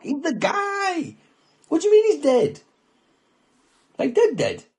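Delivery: high, loud shocked yelling; disbelieving shout. Retold or imitated. Retold shock at friend's death.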